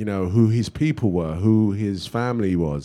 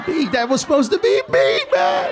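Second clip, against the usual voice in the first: high-pitched comic protest, climbing higher; mock outraged cry; 4 dB louder than usual. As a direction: wail it in hammed-up indignation. Comic outcry over lost film role.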